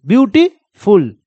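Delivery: Beautiful slowly broken into beauty and फुल; split into beats. Shown as a demonstration. Teacher showing students where the sound breaks, beautiful split slowly in two. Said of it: "अपने आप sound automatic टूट जाएगा".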